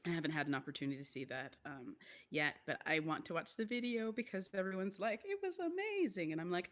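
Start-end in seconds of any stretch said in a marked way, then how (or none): 5.2-6.3 high-pitched mock rave; gushing. Quoting everyone who saw the show, high-pitched gushing. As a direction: gush like an impressed audience.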